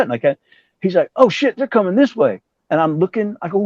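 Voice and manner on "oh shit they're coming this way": high-pitched imitation of his Georgia friend; whistling talker. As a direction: whistle through the words, sounding alarmed. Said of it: "he had this whistle when he talked".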